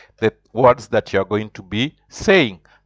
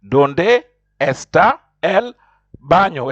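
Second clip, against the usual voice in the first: slow model phrase; 5 semitones higher, slower than usual. Shown as a demonstration. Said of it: "teacher modelling phrase".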